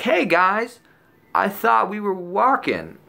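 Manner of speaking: high, drawn-out appeal to the group; mock-bewildered, stretched-out question; puzzled call-out. Acting out addressing his friends.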